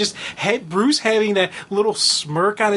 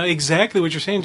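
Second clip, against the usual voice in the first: ordinary talk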